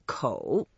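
kou low, dipping then rising; third tone. Shown as a demonstration. Teacher reviewing the first word, third tone. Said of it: "So the first word".

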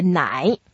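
奶 dipping down, then rising; third tone. Shown as a demonstration. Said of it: "go down first and go up".

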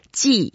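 "Ji" starting high and dropping down; falling, slightly excited. Shown as a demonstration. Teacher shows the fourth tone, "Ji" falling with slight excitement. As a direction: drop "Ji" as if a little bit excited. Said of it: "make it down as if we were a little bit excited".

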